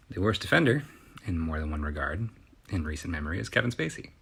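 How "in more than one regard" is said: ordinary talk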